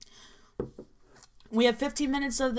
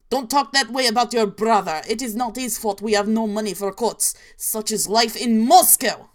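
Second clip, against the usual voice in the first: mock stern shout; 7 dB louder than usual. Father scolds daughter loudly.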